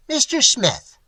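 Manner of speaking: a big man with a lightly voiced reedy sneer; thin sarcastic voice. A narrator's reading. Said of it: "in his thin, highly sarcastic voice".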